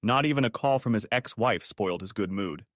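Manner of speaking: ordinary delivery, nothing marked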